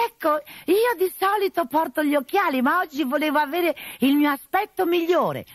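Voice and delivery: high, coy falsetto of a man posing as a lady; disguised bride voice. Acted. Arlecchino in disguise, flirting with the captain, high and coy. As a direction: play the fake bride, coy and sweet.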